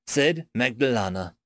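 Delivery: usual speaking voice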